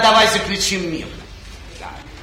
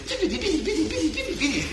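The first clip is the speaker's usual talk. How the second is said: high, soft urging; coaxing sing-song; 8 semitones higher, 5 dB quieter, faster than usual. Acted. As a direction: coax her like a child. King urging her to take ring.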